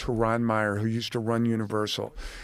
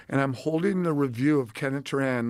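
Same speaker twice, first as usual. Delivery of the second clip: ordinary delivery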